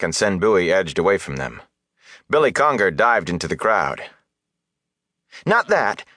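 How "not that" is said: high, hasty backtracking; quick denial. A narrator's reading. Villager backing down before the mayor, hasty. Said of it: "Darl said quickly".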